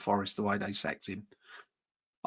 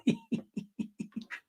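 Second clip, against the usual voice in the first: laughing; 9 dB quieter than usual. Real, not acted. Laughs at a chat message.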